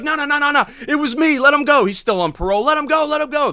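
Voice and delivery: very high, mock frantic plea; pleading to police. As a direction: beg the cops to release him. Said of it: "So I'm like".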